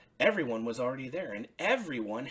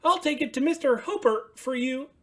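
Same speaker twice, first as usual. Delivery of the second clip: high, loud happy Bert voice; cheerful Bert offering to help; Bert, happily; 13 semitones higher, 11 dB louder than usual. Acted. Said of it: "said Bert happily".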